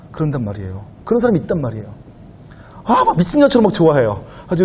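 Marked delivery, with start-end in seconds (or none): none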